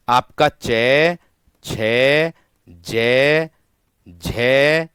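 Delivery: चय छय जय झय each sounded out slowly; teacher reciting the letters aloud; slow letter demo. Shown as a demonstration. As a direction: model each consonant clearly for students. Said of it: "चय वर्ग जो आता है".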